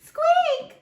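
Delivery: very high loud squeak; mouse squeak. Acted. Mouse answering Duck with a high squeak. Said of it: "we have to use the voices".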